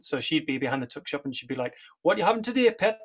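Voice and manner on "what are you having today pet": high, bright Geordie dinner lady; chirpy Geordie accent. Retold or imitated. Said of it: "like, a Geordie accent".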